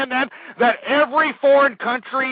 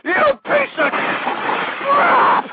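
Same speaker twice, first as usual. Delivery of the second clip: very high, slow, shouted, near cracking; screaming fury; 6 semitones higher, slower than usual. Real, not acted. Host screaming insults at listeners, breaking voice. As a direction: shriek the insult with rage.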